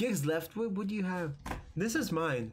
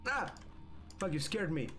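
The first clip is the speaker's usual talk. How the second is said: scream into giggles; 2 semitones lower than usual. Real, not acted. Jump scare by friends.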